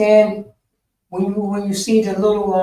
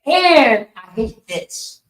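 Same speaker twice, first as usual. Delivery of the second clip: imitating the teacher; 6 semitones higher, 4 dB louder than usual. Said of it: "He say".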